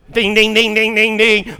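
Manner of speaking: loud, high mock crossing-bell dings; imitated train bell. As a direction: ring it out like a railroad crossing.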